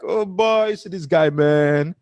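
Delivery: high, loud Nigerian street voice, teasing; loud mocking Nigerian accent; mock heavy Nigerian accent. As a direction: imitate a Lagos onlooker laughing at a heavy Nigerian accent. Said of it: "if I talk like this".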